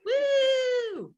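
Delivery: high, loud cheer; cheering woo. Real, not acted. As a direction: whoop it up for the winner. Cheering a winner.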